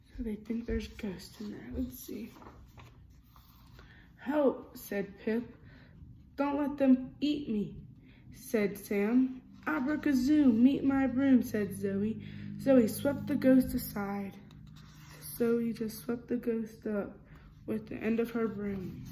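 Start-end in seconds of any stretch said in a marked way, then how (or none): 4.2-14.4 lively storybook reading, character lines a bit louder; reading to children. A narrator's reading. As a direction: read it like a bedtime picture book. Reading picture book aloud.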